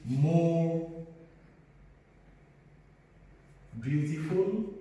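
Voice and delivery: each word spaced out slowly for the class; slow dictation. Shown as a demonstration. Teacher to class, slow dictation. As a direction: dictate it slowly so students can write. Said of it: "So in this case, we say".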